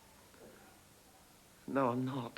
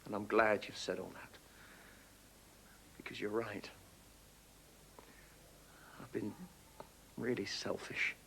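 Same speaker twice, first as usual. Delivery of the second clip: remorseful apology; 8 semitones lower, 5 dB quieter than usual. Acted. Father apologizes, subdued.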